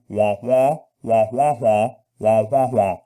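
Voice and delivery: muffled trombone wah-wah like the Peanuts teacher; sing-song wah wah of a grown-up in Charlie Brown; Charlie Brown adult. Retold or imitated. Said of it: "is Charlie Brown".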